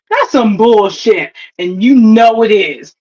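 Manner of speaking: ordinary talk, nothing marked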